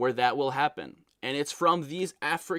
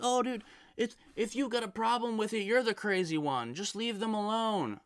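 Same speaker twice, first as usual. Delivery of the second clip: higher, sneering impression of know-it-alls; mocking dismissive voice; 8 semitones higher, 3 dB quieter than usual. Vlogger mocking people who say to ignore touts, sneering higher voice. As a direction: brush off the complaint like it's nothing.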